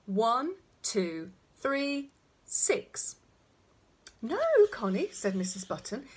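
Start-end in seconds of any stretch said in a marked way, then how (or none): 0.1-3.2 put-on toddler's firm, deliberate count; loud and clear. A narrator's reading. Connie announcing numbers on her birthday, loud and clear. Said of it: "then loudly and clearly she said". 4.2-5.1 high, louder motherly objection; emphatic correction. A narrator's reading. Mother correcting her toddler's counting, emphatic.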